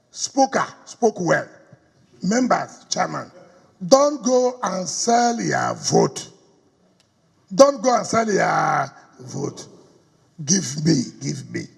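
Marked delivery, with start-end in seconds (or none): 3.9-6.3 slow plea. Real, not acted. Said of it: "So I'm begging all of you here". 7.5-9.7 slow shouted plea. Real, not acted. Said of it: "So I'm begging all of you here".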